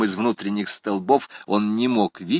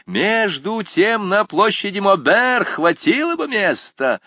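student remark; 11 semitones higher, 4 dB louder, slower than usual. A narrator's reading. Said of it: "сказал один из школяров".

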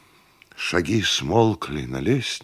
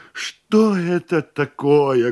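louder, higher, drawn-out lament; sorrowful wail; 7 semitones higher, 5 dB louder, much slower than usual. A narrator's reading. Host laments new tax, wailing woefully. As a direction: wail it out in grief, stretching the words. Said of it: "горестно завопил хозяин".

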